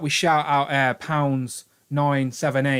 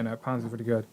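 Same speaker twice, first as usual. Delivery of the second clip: ordinary talk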